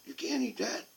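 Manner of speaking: wife's voice. Retold or imitated. Wife scolding him at store.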